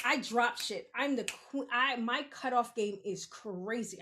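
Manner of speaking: ordinary talk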